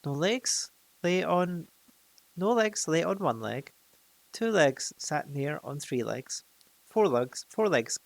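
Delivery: slow, sing-song riddle chant; riddle recital. A narrator's reading. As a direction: lay the riddle out slowly.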